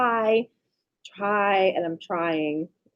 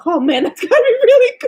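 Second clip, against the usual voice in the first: high, loud, laughing imitation; mock the daughter through laughter; laughing, high impression of a delighted kid; laughing mimic; 14 semitones higher, 10 dB louder, faster than usual. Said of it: "she goes".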